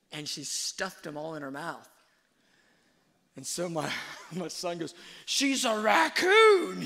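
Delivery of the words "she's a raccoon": very high, slow, full-blast holler; imitated kid's shout. Son announcing his sister raided the trash, high drawn-out shout. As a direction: holler it like an outraged little boy. Son's cry acted out.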